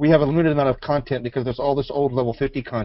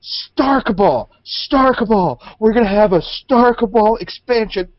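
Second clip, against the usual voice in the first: excited; 8 semitones higher, 5 dB louder, slower than usual. Retold or imitated. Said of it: "I was like".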